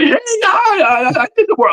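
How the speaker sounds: very high, goofy squeak; squeaky cartoon voice, tripping over itself with eagerness; Mickey Mouse. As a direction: gush like an overexcited little kid. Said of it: "talking like Mickey Mouse".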